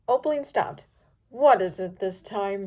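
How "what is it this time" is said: groaned. A narrator's reading. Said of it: "she groaned".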